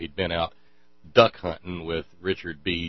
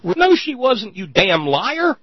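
high and loud, spitting out a slow insult; angry retort; 12 semitones higher, 10 dB louder, slower than usual. Real, not acted. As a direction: snap back at a liar. Rebutting the clip angrily.

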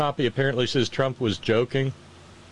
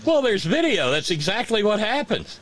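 excited; 11 semitones higher than usual. Real, not acted. Excited reply.

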